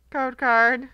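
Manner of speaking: loud dismayed moan at the card; disappointed groan. Real, not acted. Pack opener groaning at another code card. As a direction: sigh it out like a letdown.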